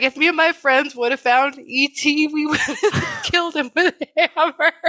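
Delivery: cracking up toward the end; laughing while quoting. Real, not acted. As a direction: struggle to get the quote out through laughter.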